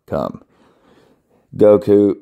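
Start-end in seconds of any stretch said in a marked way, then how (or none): 0.1-0.4 spelled then spoken; deadpan low Come. Shown as a demonstration. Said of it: "C U M".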